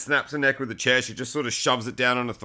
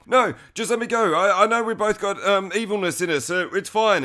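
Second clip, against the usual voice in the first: higher, pushy begging voice; mock pleading killer; 6 semitones higher, 4 dB louder than usual. Strangler begging Phoebe to let him go, mock plea. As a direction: plead for your life, half-joking.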